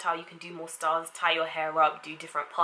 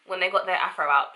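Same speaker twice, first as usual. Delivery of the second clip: ordinary delivery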